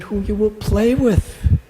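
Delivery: high, loud challenge leaning on "play"; challenging. A narrator's reading. Gopi questions Krishna about his playmates, challenging.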